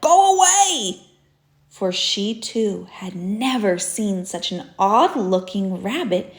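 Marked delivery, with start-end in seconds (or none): 0.0-1.0 higher, louder, shooing away; a fussy duck yelling Go away; shrill duck shout. Acted. Missus Puddle Duck chasing the rabbit off, loud high shout. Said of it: "she said".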